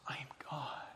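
an unvoiced whisper of the phrase; whispered mantra. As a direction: breathe it out as a secret chant. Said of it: "you tell, you say".

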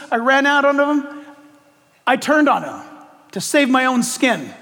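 high, pleading confession of failure; anguished confession. Acted. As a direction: confess the betrayal, voice rising. Peter confessing in first person.

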